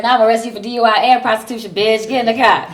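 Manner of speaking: loud, rapid-fire cop order; mock stern arrest. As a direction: rattle it off loudly like an annoyed officer. Retold cop announces arrest.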